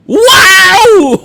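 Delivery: high, loud laughing shout; big laughing whoop; amazed wow. Real, not acted. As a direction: blurt it out, cracking up. Reacting to senator's flowery speech.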